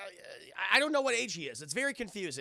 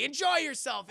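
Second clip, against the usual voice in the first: loud, raised send-off; hearty mock shout; 7 semitones higher, 7 dB louder than usual. Friend urging Clark to enjoy the party, hearty shout.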